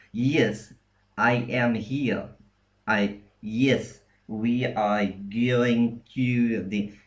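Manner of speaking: slow, hammed-up Russian accent with y-glides everywhere; bad Russian accent. Shown as a demonstration. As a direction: put on a bad Russian accent, over-palatalized. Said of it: "someone doing a bad Russian accent".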